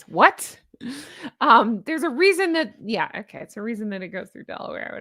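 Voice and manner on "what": punchy, rising incredulous what; Jason Mantzoukas. Retold or imitated. Host catching herself sounding like a comedian, Jason Mantzoukas impression. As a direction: throw out a loud questioning yelp. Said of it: "I sound like Jason Mantzoukas".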